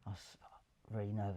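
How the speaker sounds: ordinary talk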